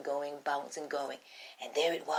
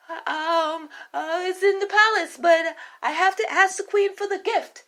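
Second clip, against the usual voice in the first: much higher, fumbling servant voice; jittery cook in a squeaky high voice; nervous cook; 13 semitones higher, 5 dB louder than usual. Acted. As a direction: stammer excuses to your queen. Cook answers the rabbit.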